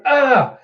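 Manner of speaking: high, short mock scream; acted cry of pain; imitated yell. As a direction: yelp as if hurled away. Imitates cry of one thrown.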